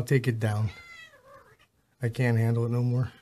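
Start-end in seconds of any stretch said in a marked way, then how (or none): none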